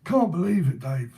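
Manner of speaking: put-on cockney gaffer; imitated cockney. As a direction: act out an indignant London geezer. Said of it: "trying to act it out".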